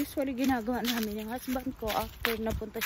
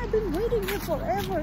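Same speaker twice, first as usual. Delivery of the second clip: daughter's voice; 10 semitones higher, 6 dB louder than usual. Retold or imitated. Daughter quoted in mom's story.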